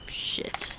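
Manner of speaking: breathy whispered curse; sighed whisper. Acted. Young man reading a message, whispered curse on a sigh. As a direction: let it out on a sigh.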